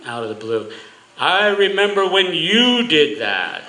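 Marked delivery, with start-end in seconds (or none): 1.2-3.6 Italian grandfather voice, higher, louder and slow; thick Italian accent. Retold or imitated. Son imitating his Italian grandfather teasing his dad, in a thick Italian accent. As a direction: drawl it out in a heavy Italian accent like an old immigrant. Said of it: "you hear this thick Italian accent".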